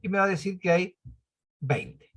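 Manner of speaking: usual speaking voice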